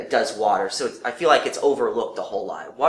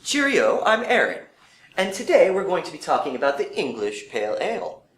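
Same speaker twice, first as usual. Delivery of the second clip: hammed-up English accent, pitched higher at the start; bad English accent; 6 semitones higher than usual. Host greets viewers in a self-mocking English accent. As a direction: put on a cheery, clumsy English accent for the greeting. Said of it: "That was my best impression of it, uh, in an, uh, best English accent".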